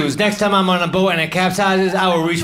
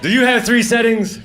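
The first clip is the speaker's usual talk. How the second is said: mock interrogation; 4 semitones higher than usual. Interrogating the fan.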